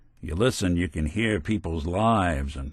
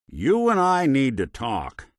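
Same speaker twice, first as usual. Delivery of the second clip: raised, insistent demand; stern confrontation; 6 semitones higher, 4 dB louder than usual. Acted. Investigator confronting someone he has business with, stern. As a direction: confront them firmly, pitched up.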